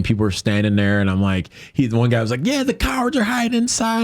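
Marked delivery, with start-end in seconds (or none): 2.4-4.0 high-pitched mock crowd heckle; imitated jeer. Man in the crowd heckling those inside, imitated jeer.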